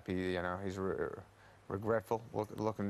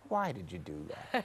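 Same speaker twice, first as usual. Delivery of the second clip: a hint of a critical mother; lightly voiced mum, puzzled and reproachful; disapproving mum. Son mimicking his mother judging his movies. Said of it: "It's always like".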